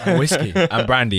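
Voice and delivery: laughing. Real, not acted. Joking about drinks.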